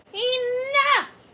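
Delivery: very high, loud, cross shout; stern shout. Acted. Teacher stopping the class chaos with a shout. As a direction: cut them off sharply.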